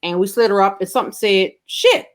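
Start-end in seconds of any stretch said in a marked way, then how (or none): 1.7-2.1 a sudden high yelp of a curse; mother's surprise curse. Retold or imitated. Mother yelps as she is slid up, high blurted curse. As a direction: blurt it high, like a startled old woman.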